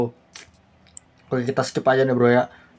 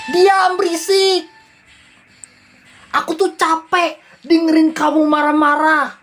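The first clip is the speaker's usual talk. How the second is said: slow, higher voice, mildly fed up; angry husband; 10 semitones higher, slower than usual. Acted. Husband telling his wife to shut up, mildly fed up. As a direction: draw out the husband's tired scolding.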